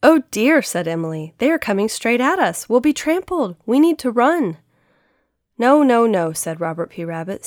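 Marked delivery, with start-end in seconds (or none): 0.0-0.6 high, loud cry of alarm. Acted. Emily fears an oncoming stampede. 1.4-4.6 raised, urgent voice of alarm. Acted. Emily fears being trampled. 5.6-6.3 emphatic refusal. Acted. Rabbit counters Emily's panic.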